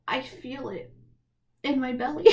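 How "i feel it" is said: ordinary talk, nothing marked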